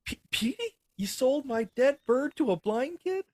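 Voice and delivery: high, squealed, stammering outrage; high-pitched hammed-up shock; mock disbelief. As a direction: squeal it out, aghast, dragging the words. Acted shock over dead pet bird.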